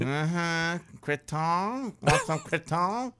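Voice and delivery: high, slow coaxing offer; imitating the wife. Wife coaxing husband to try creton, high and teasing. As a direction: dangle it like a treat. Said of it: "And then she goes".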